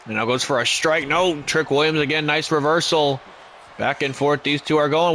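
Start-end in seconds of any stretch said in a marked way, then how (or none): none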